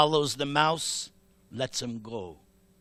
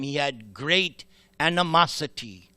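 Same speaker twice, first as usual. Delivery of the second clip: usual speaking voice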